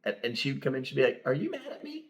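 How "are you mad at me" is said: wife asking. Retold or imitated. Wife voiced in story.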